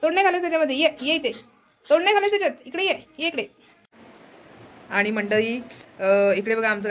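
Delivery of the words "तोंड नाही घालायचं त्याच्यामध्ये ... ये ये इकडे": much higher scolding calls; high scolding to the bird, half laughing; scolding the pet. Real, not acted. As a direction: tell the parrot off, high.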